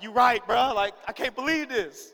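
mock outraged disbelief; fired up. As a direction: get riled up with your friend. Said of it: "fuel's being in my fire".

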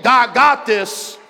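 "God got this" slow, high and loud; loud slow declaration of faith; convinced shout. Shown as a demonstration. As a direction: proclaim it until you believe it. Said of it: "By about your eighth".

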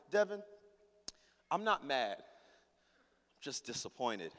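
low, soft, with heavy pauses; grave, hurt restraint; cutting disappointment. Acted. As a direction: deliver a quiet guilt trip. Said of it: "I'm trying to cut her deep".

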